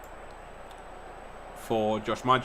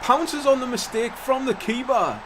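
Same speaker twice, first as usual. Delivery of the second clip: much higher, loud shout; excited goal call; 11 semitones higher, 7 dB louder than usual. Real, not acted. Calling the goal to his viewers, excited shout. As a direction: yell the goal out.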